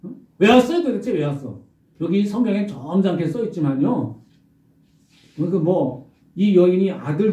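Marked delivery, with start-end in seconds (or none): 0.0-1.6 high, loud accusing outburst; angry protest. Acted. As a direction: hurl the question at him. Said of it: "따집니다". (선지자에게 소리치며 따지는 어머니)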